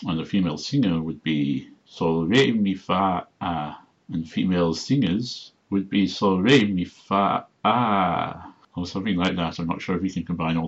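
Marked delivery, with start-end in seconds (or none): 1.9-3.8 stressed re, then fa broken into fa-a; glottal stop. Shown as a demonstration. Showing female singer, RE stressed and fa-a split. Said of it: "put a glottal stop between it". 6.1-8.5 stressed re, fa, a break, then a long held a; lengthened vowel with glottal stop. Shown as a demonstration. Showing female singers, fa broken before a long a. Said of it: "lengthen the vowel and put a glottal stop".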